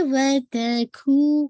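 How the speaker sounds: وَتَكُو with کو stretched two counts; slow recitation demo; two-count koo. Shown as a demonstration. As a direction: draw out کو in وَتَكُو. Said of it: "کھو آپ کا ٹو count ہے".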